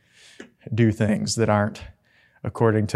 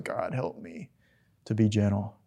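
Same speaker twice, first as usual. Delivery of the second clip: much softer, a hushed prayer; 9 dB quieter than usual. Retold or imitated. David praying privately for gentleness, hushed and soft. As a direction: murmur it as a private plea to God.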